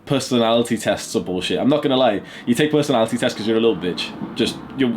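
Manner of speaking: usual speaking voice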